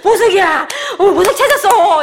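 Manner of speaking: high, loud, laughing cry of joy; excited fuss. Shown as a demonstration. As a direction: shout it out as if you just struck gold. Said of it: "호들갑을 떨고 막 좋아가지고".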